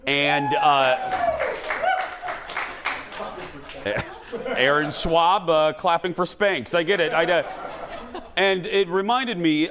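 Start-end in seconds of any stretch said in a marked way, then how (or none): 1.0-4.5 high laugh. Real, not acted. Host bursts out laughing.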